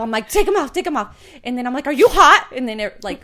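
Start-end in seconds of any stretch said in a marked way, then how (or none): 0.3-1.2 high, loud frantic urging; mother hurrying kids out of sweaters, frantic. Retold or imitated. Frantic mother stripping kids' sweaters. 1.9-2.5 high, loud worried check; mother checking if kids overheat, panicky; anxious loud question. Retold or imitated.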